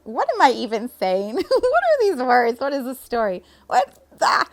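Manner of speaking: baffled and amused at her own words; bewildered. Retold or imitated. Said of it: "I'd be like".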